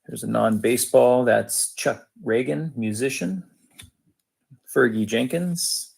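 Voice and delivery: usual speaking voice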